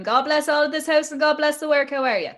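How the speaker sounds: high call-out to the house; homecoming greeting. Retold or imitated. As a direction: breeze in hailing the family. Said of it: "coming in to me mammy going".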